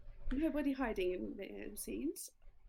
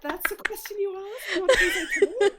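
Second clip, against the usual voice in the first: high, loud, laughing disbelief; louder, higher incredulous cry; incredulous outburst; 10 semitones higher, 13 dB louder than usual. Real, not acted. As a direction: shout it in disbelief through laughter. Laughing at absurd question.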